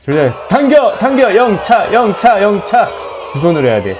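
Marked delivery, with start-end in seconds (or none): 0.5-2.9 higher rhythmic pulling cries; heave-ho shouts. Pretend. Gamer cheering on his tug-of-war team, rhythmic heave shouts.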